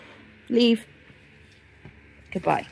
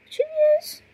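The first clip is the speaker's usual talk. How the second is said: surprised at own mistake. Real, not acted. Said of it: "No, a year".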